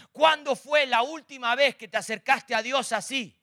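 weighty challenge to the congregation; pointed question. Acted. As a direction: lean into "Cuándo" to confront the listener. First asking, pointed.

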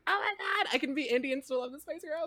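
high-pitched giddy squeal. Retold or imitated. Recalling a first watch of the movie, mock giddy excitement. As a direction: burst out with giddy delight, voice way up. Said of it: "being so giddy on the inside".

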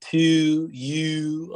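drawn-out Baltimore "you" vowel; mock a Baltimore accent on "To you". Shown as a demonstration. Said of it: "hear a Baltimore accent".

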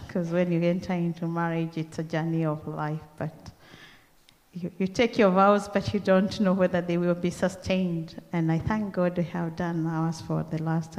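ordinary delivery

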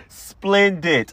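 a high, thrilled Splendid; gleeful splendid. Acted. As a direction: squeal Splendid with joy. Repeats it higher after laughing.

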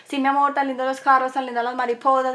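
sweet voice to drown out the fight; sing-song to baby. Retold or imitated.

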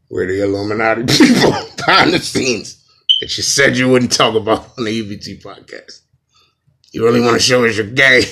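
loud, laughing secret-society boss; hammed-up shadowy boss, breaking into laughter; Illuminati voice. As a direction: boom it out as a mock Illuminati spokesman. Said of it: "Okay, Illuminati voice".

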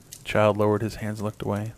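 ordinary delivery, nothing marked

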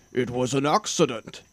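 mild storybook dragon voice; a lightly voiced dragon protesting; dragon roar. A narrator's reading. Dragon defends himself against the king, mild mock roar. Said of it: "he roared".

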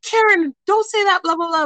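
retold mom's yell at the rude daughter, mild; mock yell. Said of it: "who yelled".

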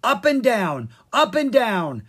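loud, high gleeful chant; a loud, playful yell. Acted. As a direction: yell it in a singsong on the seesaw. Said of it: "Pix yelled".

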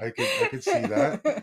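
high laughing speech. Real, not acted. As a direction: laugh while saying it. Still laughing at joke.

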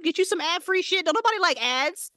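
usual speaking voice

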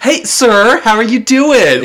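high, loud mock-chipper greeting; overly chipper. Guy mimicking a greeting he'd never get, overly chipper. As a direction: put on a gushing, shrill-pitched cheer. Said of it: "isn't gonna be overly chipper".

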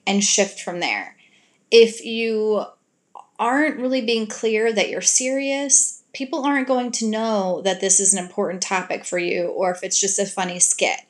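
usual speaking voice

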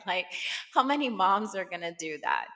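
normal talking voice

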